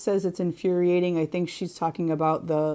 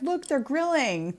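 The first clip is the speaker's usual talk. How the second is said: laughing; 8 semitones higher, slower than usual. Retold or imitated. Retold spotting neighbours grilling.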